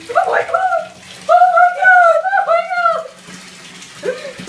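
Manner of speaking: high, loud panicked screams; screaming. Real, not acted. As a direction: shriek at the freezing cold. Scream at ice water.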